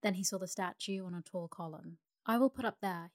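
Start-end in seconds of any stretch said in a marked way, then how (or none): 2.3-3.1 a lightly voiced glad cry, louder. A narrator's reading. Swallow spotting the statue for the night, pleased louder cry. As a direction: call out the choice of a perch, pleased and louder. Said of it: "he cried".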